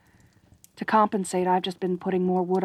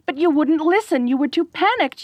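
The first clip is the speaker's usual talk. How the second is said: flustered defence; 8 semitones higher than usual. Acted. Defensive exasperated explanation.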